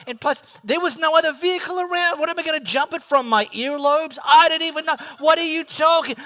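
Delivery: high, loud, fast incredulous rant. Retold or imitated. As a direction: sputter in disbelief. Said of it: "And I'm like".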